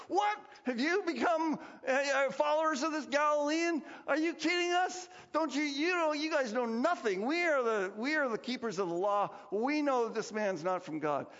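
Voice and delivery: high, loud, scornful outrage; indignant priests. Retold or imitated. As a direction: bark it like offended religious leaders. Sanhedrin outraged at soldiers.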